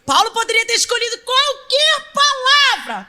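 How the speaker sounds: very slow, loud, high proclamation; solemn pulpit proclamation; preaching at full force. Acted. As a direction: proclaim it to the whole church.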